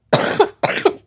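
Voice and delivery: dry real cough. Real, not acted. Sick vlogger coughing mid-sentence, real cough. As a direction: cough into the mic. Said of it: "je vous dis ça en toussant".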